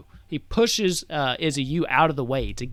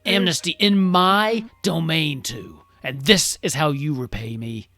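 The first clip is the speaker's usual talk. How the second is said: loud, higher, imperious anger; indignant demand; 3 semitones higher, 6 dB louder than usual. Acted. King demands who allowed the wedding, indignant. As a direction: hammer the key words in outrage.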